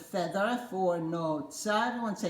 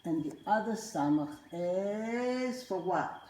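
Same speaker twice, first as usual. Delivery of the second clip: normal talking voice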